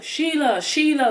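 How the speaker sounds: imitating someone calling out a wrong name; mock calling out. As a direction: hail her like a stranger on the street. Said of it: "they were like".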